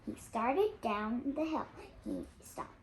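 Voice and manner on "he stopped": low, hushed, sudden stop. A narrator's reading. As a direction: freeze with dread. Sudden dread.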